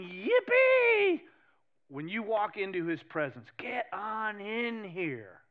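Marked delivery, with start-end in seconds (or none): 0.0-1.2 high, loud gleeful yippee; joyful God. Acted. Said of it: "the Father is going". 3.6-5.4 inviting God. Acted.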